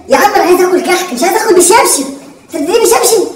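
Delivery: high-pitched aggrieved complaint; indignant protest. Acted. Son protesting father's slipper blows, high and indignant.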